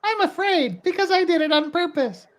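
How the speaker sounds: high, childlike cartoon kid voice; Ralph Wiggum voice. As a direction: sound like a dim little cartoon boy. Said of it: "This sounds like Ralph Wiggum".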